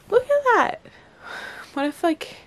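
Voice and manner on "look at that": much higher and louder delighted cry; real thrilled exclamation; excited squeal. Real, not acted. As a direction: burst out in real wonder. Same words said twice.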